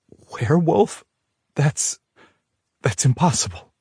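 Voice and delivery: high, stunned disbelief; can't believe it; disbelieving. A narrator's reading.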